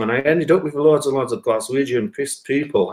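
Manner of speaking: ordinary delivery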